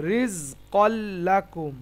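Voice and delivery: "রিজকল লাকুম" slow, the l held across both; doubled l. Shown as a demonstration. Teacher giving a similar example, doubled l. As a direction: recite "রিজকল লাকুম" with one long joined l. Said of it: "একইভাবে".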